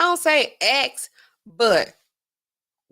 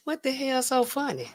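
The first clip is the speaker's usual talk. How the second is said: inner voice; 3 semitones higher, 7 dB quieter than usual. Retold or imitated. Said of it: "In my head".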